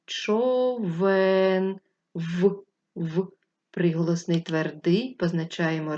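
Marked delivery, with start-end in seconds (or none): 0.0-1.8 Човен drawn out slowly, each sound clear; slow teacher sound-out; word sounded out. Shown as a demonstration. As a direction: stretch Човен so children hear every sound. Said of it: "промовляємо ще раз".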